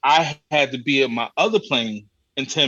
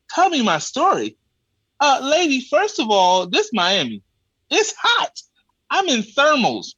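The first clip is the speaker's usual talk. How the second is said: exasperated rant; 10 semitones higher than usual. Retold or imitated. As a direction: let the outrage climb high at the lady. Ranting at the ticket lady.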